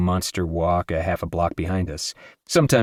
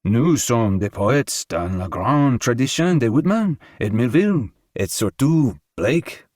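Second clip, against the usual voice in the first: lightly raised, slow elegant French; hint of a well-groomed Hungarian gentleman; slow, courtly French; most elegant tone; 4 semitones higher, slower than usual. A narrator's reading. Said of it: "in his most elegant tone".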